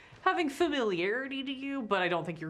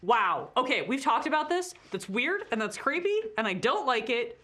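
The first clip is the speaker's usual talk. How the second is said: higher, grossed-out scolding; disgusted escort; 5 semitones higher, 4 dB louder than usual. Acted. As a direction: scold the staring beholder, squeamish. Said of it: "she's like".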